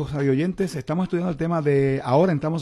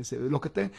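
ordinary talk, nothing marked

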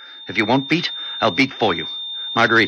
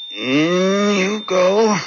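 straining concentration; 7 semitones higher, much slower than usual. Acted. Doctor inserting the heart needle.

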